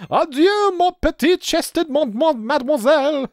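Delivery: very high, loud hammed-up French accent; mock French. Pompous villain bidding farewell in mock French.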